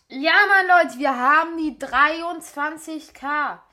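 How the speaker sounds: loud, slow triumphant cheer; excited. Real, not acted. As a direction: celebrate the win out loud. Finally reaching the goal. (laut jubelnd)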